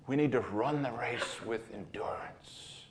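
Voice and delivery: whispered urgent plea; hushed and intense to the congregation; earnest whisper. Real, not acted. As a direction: drop to a whisper, stressing need. Preacher drops to earnest whisper.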